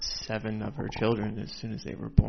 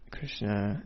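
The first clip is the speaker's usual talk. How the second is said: usual speaking voice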